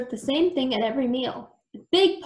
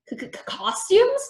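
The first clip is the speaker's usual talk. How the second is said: stuttered, high-pitched puzzled question; bewildered stutter rising high; baffled; 10 semitones higher, slower than usual. A narrator's reading. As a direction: stammer it out slowly, totally baffled.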